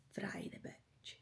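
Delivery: breathy cheeky whisper, slowed; whispered aside. Real, not acted. Vlogger telling viewers the cooking step, whispered cheekily. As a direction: drop to a conspiratorial whisper.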